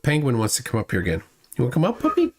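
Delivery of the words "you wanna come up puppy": high coaxing pet voice; doggy talk. Real, not acted. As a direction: coax the dog up in a high sing-song. Coaxing the dog onto lap.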